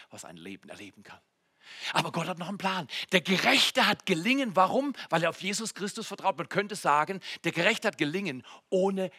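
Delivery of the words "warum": ordinary delivery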